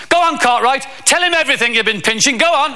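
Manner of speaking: high, hurried telling-off; scolding teacher. Acted. Passing teacher goading a pupil to confess, high and scolding. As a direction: goad the pupil into owning up, pitched high. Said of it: "there was a teacher passing".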